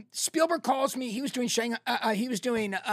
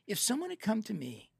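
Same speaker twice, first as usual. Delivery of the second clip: ordinary talk, nothing marked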